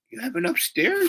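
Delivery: high falsetto question; squeaky mock disbelief; astonished girl. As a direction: imitate a girl who can't believe it.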